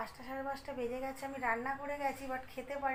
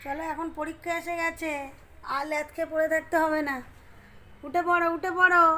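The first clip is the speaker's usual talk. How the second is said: louder, higher, rousing the daughter from bed; loud wake-up call; 3 semitones higher, 9 dB louder than usual. Real, not acted. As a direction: call her up out of laziness. Mother waking lazy daughter. (জোরে ডেকে তোলা)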